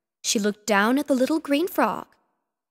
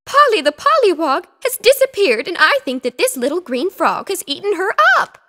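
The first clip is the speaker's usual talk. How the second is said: higher, louder fretful lady voice; upset; 7 semitones higher, 7 dB louder than usual. Acted. Missus Picasso explaining to Mister Petsky, upset. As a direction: pour out your worry.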